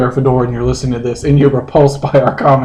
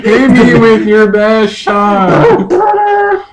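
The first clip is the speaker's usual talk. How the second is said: higher, louder, very slow and drawn out; audition voice; 7 semitones higher, 4 dB louder, much slower than usual. Pretend. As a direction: stretch each word like a ham actor. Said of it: "let's audition".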